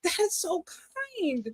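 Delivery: high, tearful gratitude; moved to tears. Real, not acted. Host hearing that she is valued, tearfully touched. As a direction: coo it through tears. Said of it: "Are you crying?".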